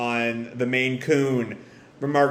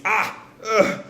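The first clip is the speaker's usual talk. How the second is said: high gasp, then a groan; acted grunts of a wounded man; pained groan; 7 semitones higher than usual.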